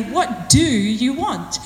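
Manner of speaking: slow question leaning hard on "do"; stress on do. Shown as a demonstration. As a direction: stretch out "do", very slowly. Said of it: "put stress or emphasis on a different word".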